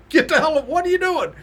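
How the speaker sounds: high, loud, laughing yelp of a startled coach; squeaky high mock coach yelling through laughter. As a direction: shriek it like someone being pounded on the back for no reason. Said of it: "his coach is like".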